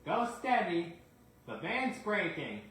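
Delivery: high, loud alarmed warning; anxious shout. Acted. Anxious conductor calling out.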